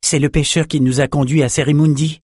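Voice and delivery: lightly voiced young scholar; announcing young scholar. A narrator's reading. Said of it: "annonça le jeune érudit".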